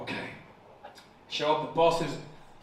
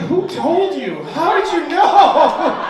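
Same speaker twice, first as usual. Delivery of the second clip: much higher, louder, slow and stretched; mock horrified; 10 semitones higher, 9 dB louder, slower than usual. Teacher mock-shocked at the child's word, higher slow. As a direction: play shocked that she found out. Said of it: "And I'm sat there like".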